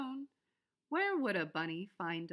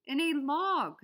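higher, loud gruff reply; groundhog answer; 6 semitones higher, 8 dB louder than usual. A narrator's reading. Groundhog answering the bunny, loud. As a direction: snap it out. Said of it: "said the groundhog".

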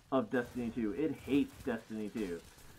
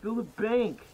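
higher, louder urging; urgent shout; 8 semitones higher, 6 dB louder than usual. Real, not acted. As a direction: yell it at the team.

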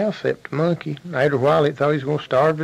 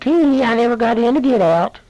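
high, loud gloating squeal; mock the bear in a piercing falsetto; laughing monkey; 10 semitones higher, 6 dB louder than usual. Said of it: "just a laughing, laughing, and said".

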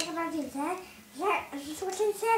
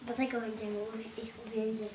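low growly plotter; deep villain voice; 12 semitones lower, 11 dB quieter than usual. Pretend. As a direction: drop the voice low and scheming. Plotting heroes, deep toy voice.

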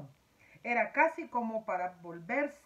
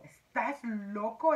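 exclaimed; 6 dB louder, slower than usual. A narrator's reading. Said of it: "exclamó el gusano".

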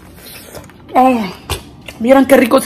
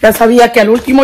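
ordinary talk

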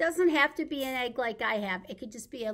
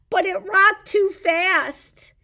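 higher and louder, drawn out; bouncy excited telling; 7 semitones higher, 9 dB louder than usual. Acted. Reading Three Bears to babies, acting the too-fast rocker excitedly. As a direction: make the rocking sound wild.

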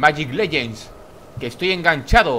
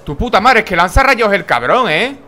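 loud, higher angry swearing; frustrated cursing; 5 semitones higher, 6 dB louder than usual. Real, not acted. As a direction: vent at the boss out loud. Boss blasts him.

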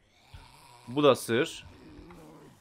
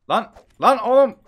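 high, loud outburst; startled shout; 10 semitones higher, 12 dB louder than usual. Real, not acted. Player jolted by a zombie attack, loud startled shout. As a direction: yell out in sudden alarm.

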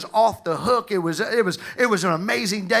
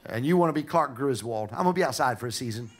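low, soft throwaway line; understated, muttered punchline; deadpan comic aside; 7 semitones lower, 5 dB quieter, faster than usual. Acted. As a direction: drop it low and quiet after the big build-up. Comic aside after dramatic build-up.

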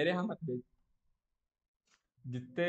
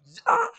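loud re-enacted roar; a fearless yell, acted out; powerful roar; 9 dB louder than usual. Showing how he roared in his dream without fear, powerful roar. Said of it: "एकदम ऐसा powerful वाला".

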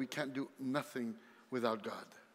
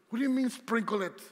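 louder, higher, baffled "Sprinkle it"; incredulous; 7 semitones higher, 5 dB louder than usual. Real, not acted. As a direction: throw the phrase back in disbelief. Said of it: "incredulous repeat of mom's advice".

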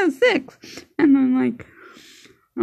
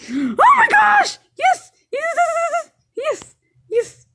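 excited cheering; 13 semitones higher, slower than usual. Real, not acted. Kid gamer cheering after a kill. Thrilled gaming win.

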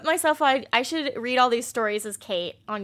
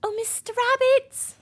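squeaky Alice voice; 10 semitones higher, 4 dB louder than usual. Acted. Repeating the Alice voice.

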